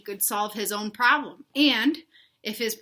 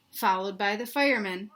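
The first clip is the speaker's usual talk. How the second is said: plain reading voice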